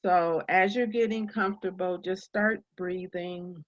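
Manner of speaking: unhurried meditation guide with long pauses; calm, spacious guiding voice; guided meditation. Real, not acted. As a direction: ease listeners into breathing, leaving space between phrases.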